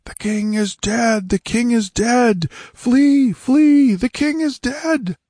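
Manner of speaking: high, drawn-out battlefield wail; panicked crowd crying out, pitch climbing; frenzied cries. A narrator's reading. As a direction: build to a shouted Flee. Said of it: "rising and rising in frenzy".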